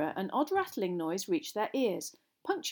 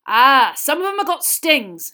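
loud, higher, drawn-out delight; enthusiastic; 5 semitones higher, 13 dB louder than usual. A narrator's reading. Hagrid answering Lavender about stings, loudly enthusiastic. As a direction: announce it with booming relish. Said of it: "said Hagrid enthusiastically".

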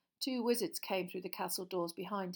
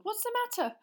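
high, concerned question; startled; 3 dB louder than usual. Acted. Teacher asking the screaming girl what happened, startled. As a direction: ask quickly, alarmed. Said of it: "said Lupin, startled".

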